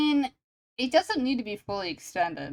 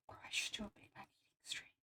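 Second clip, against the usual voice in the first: whispering; 21 dB quieter, much faster than usual. Real, not acted. Aside whispered to himself.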